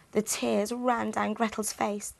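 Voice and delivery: ordinary delivery, nothing marked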